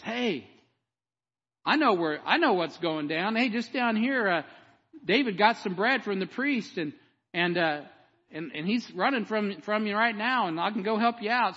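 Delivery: a hint of a slick dude offering help; slick informer. Preacher plays the Edomite tipping off the king, lightly slick. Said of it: "this is one of those slick dudes".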